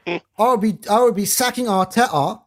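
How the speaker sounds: loud, high put-on English accent; mock English accent. Shown as a demonstration. Co-host joking about sacking Arteta, loud mock English accent. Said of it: "that's a decent English accent you got there".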